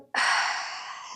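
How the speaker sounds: breathy release sigh; long sigh. Shown as a demonstration. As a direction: let it all out in one long sigh. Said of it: "sigh and let the breath".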